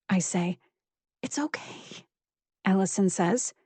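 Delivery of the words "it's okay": much softer, whispered comfort; calm her in a hush; whispered reassurance. A narrator's reading. Allison soothing, whispered.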